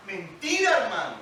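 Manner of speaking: fiery preaching. Acted. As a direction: drive the point home to the congregation. Preaching at full force. (predicación con fuerza)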